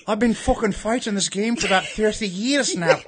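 swaggering Irish fighter's brogue; Conor McGregor. Acted. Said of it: "pretend you're Conor McGregor".

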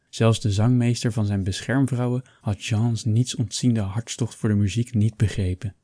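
plain reading voice

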